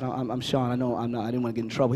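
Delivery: put-on low, soft, hesitant child's reply; mildly timid, retold from childhood; a touch of worried stammer; nervous kid. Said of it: "I freaked out a little bit".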